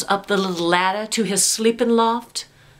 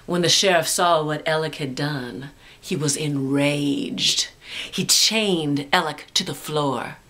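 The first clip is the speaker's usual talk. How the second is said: ordinary delivery, nothing marked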